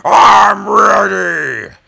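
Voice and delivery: put-on high, loud, slow "Booyah, baby!"; retold hype shout. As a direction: hype yourself up like a gamer. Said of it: "I was like".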